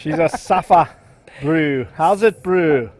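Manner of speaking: light put-on South African accent; a hint of South African accent. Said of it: "There's my South African accent".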